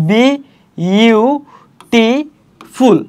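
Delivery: "বি ইউ টি ফুল" with a long pause after each part; syllable by syllable. Shown as a demonstration. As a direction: spell out the four beats: বি, ইউ, টি, ফুল. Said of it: "কিভাবে উচ্চারণ করছি দেখো".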